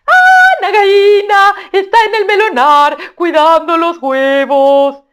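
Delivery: very high, loud, slow bird voice, half sung; a gleeful tailorbird crowing praise; sing-song high loud shout. Acted. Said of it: "dónde está Nagaína".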